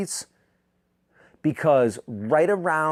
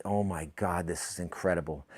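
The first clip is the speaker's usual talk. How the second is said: hushed; 7 semitones lower, 10 dB quieter than usual. Retold or imitated. Quote in the room with a newborn.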